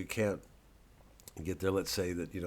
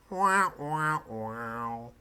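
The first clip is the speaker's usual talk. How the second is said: mock sad trombone; 3 semitones higher, 4 dB louder, much slower than usual. Mocking his own modesty with a sad trombone. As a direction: sing a deflating trombone wah wah.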